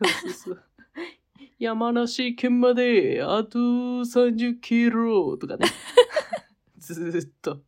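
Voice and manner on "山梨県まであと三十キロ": very slow, drawn-out cartoon voice; Anago-san impression. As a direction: read the sign like Anago. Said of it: "アナゴさんで。".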